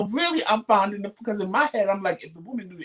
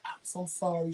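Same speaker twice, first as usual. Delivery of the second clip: mouthed apology; 9 dB quieter than usual. Retold or imitated. Embarrassed mother apologises to a stranger on the train, mouthed softly. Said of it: "She mouthed to me".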